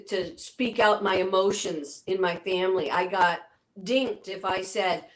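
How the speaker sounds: ordinary delivery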